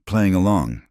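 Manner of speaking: usual speaking voice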